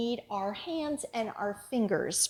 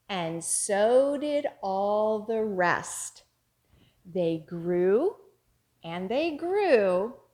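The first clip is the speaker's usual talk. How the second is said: slow, louder chanted rhyme; action rhyme; 2 semitones lower, 3 dB louder, slower than usual. Shown as a demonstration. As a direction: chant together with the children. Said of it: "as we say our finger play together".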